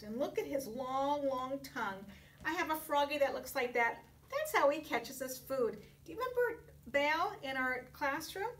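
ordinary delivery

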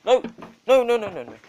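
loud, high, frantic no's; alarmed loud no's; panicked shouting. Real, not acted. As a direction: yell no at the dog in alarm.

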